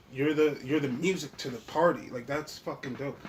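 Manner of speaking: usual speaking voice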